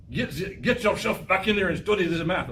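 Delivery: lightly put-on Russian accent, stern; a hint of a stern Russian teacher. Teacher imitates a Russian teacher, light mock Russian accent. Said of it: "sorry about that accent".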